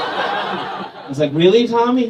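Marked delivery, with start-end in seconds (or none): 1.3-2.1 loud, raised-pitch disbelief; mock offended. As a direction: challenge the kid in mock indignation. Acted offended counselor questioning child.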